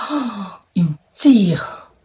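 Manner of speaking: slow, breathy whisper with a crying catch; pained whisper. Real, not acted. Retelling the guy pulling her, in a pained whisper. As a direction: whisper it like it hurts to say. J'aime même pas les mots.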